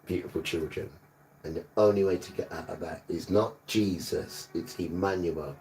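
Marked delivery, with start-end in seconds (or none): none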